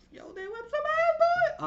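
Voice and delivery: very high, loud, hyped-up squeal; excited squeal. Real, not acted. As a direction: burst into a high squeal of awe. Awed outburst about the fight.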